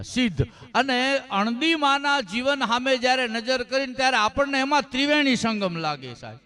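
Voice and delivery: usual speaking voice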